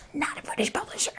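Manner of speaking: quick, high joking whisper; hushed mock confidence; whispered aside. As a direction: whisper it fast, as a comic aside. Joking aside about the other book.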